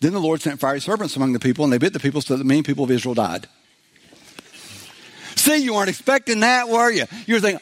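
ordinary delivery

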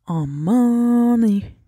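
stretched out slowly like a groan of discomfort; drawn-out groan. Real, not acted. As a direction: drag out Aman ey as she strains. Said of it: "yine tuvaletim geldi".

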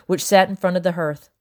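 usual speaking voice

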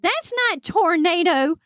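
high-pitched mock disbelief; incredulous correction. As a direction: set her straight, acting out baffled sergeants. Sergeants deliver the deflating correction.

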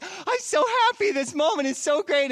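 high, loud gushing joy, hammed up; mock happy crying. Preacher mocking a happy Jesus at the triumphal entry, gushing. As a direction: squeal it like a mock overjoyed fan. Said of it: "it's not crying 'cause he's happy".

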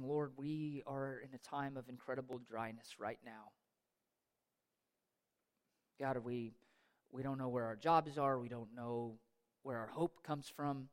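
subdued plea to God; hushed lament. Acted. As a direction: pray it quietly, worn out. Psalmist's dry-season prayer voiced.

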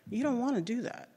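ordinary talk

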